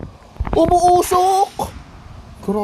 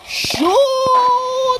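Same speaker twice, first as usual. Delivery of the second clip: launch shout; 11 semitones higher than usual. Real, not acted. Launch call.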